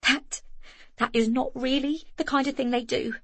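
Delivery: shivering, teeth chattering. A narrator's reading. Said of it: "through chattering teeth".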